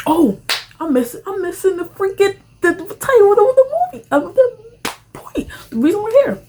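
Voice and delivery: high, loud exasperated rush; high frustrated. Real, not acted. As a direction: blurt it out, frustrated. Forgot the movie title.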